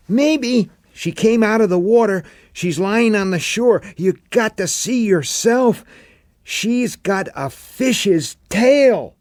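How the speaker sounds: put-on a kid uneasy and insistent; young boy, spooked. A narrator's reading.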